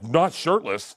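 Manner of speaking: loud, offended retort; mock indignant. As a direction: bark it like a hurt diva. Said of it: "I was like".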